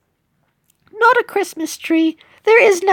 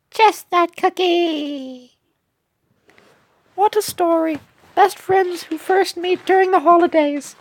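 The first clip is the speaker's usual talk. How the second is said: cheerful cookie characters, British. Acted. Cookies invite a new friend to a party, cheerful British voices. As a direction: act out sweet British cookies making friends.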